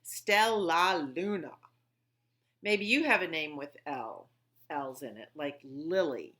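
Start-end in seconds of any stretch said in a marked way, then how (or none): 0.0-1.5 "Stellaluna" slow with a long L in the middle; stressing the L's. Shown as a demonstration. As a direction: lean on the L in "Stellaluna". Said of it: "Listen to all those L's".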